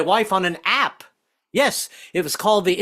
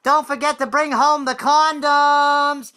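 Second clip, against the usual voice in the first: high, slow, drawn-out yell; wife yelling down; 10 semitones higher, 4 dB louder, slower than usual. Retold or imitated. Wife yelling down to her husband in the street. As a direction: yell down six stories. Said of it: "my wife would yell down six stories".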